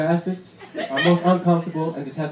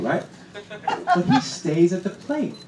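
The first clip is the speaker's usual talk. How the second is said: ordinary talk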